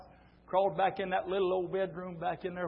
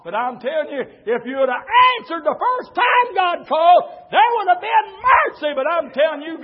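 loud, high hellfire preaching; preaching at full force; 11 semitones higher, 15 dB louder than usual. Acted. Preacher thundering a warning to the church. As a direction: thunder it at the congregation.

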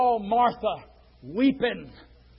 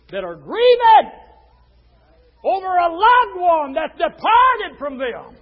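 loud, raised, drawn-out pulpit cry; full-force preaching; 8 semitones higher, 10 dB louder, slower than usual. Real, not acted. Preacher tells of two grieving women, full-force preaching. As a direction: belt it out to the congregation.

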